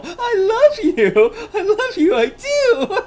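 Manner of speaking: high, loud laughing love declaration; laughing confession. Acted. Girl declaring love to the boy, high laughing gush. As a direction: gush it out through laughter, slowly.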